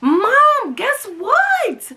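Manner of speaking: high eager voice; lightly voiced gushing teen; excited daughter. Daughter telling mom a rapper liked her comment, high eager. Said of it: "and she's like".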